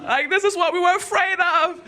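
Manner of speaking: high, hammed-up alarm; acted high-pitched warning; pleading heart. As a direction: plead in mock panic. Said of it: "my heart was like".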